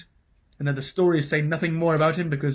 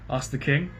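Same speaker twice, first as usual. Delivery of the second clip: ordinary delivery, nothing marked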